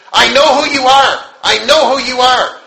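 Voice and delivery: high, loud, insistent cry; urgent calling out. Retold or imitated. Woman running towards the preacher, calling out urgently.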